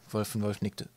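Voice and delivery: ordinary delivery, nothing marked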